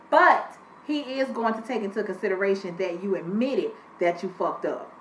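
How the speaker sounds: usual speaking voice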